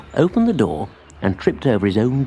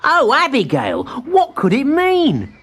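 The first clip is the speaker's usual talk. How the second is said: put-on high, eager child-like voice; young tree voice; 13 semitones higher, 3 dB louder, slower than usual. A narrator's reading. Timbertwig thanks his spider friend, high and eager. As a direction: sound small and pleased.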